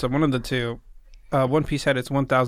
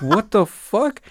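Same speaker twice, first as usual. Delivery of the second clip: high-pitched mock disbelief; imitated mind-blown shock; 7 semitones higher, 3 dB louder, slower than usual. Teacher shown the slider, imitated mind-blown shock. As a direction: play her stunned outburst, lightly hammed up. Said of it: "her mind just exploded".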